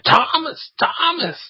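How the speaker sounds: very high, put-on shriek for the cat; cartoon housewife calling. Woman on a chair calling Tom, high cartoon cry. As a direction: call the cat in a panicky falsetto. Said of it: "she jumps up on the chair".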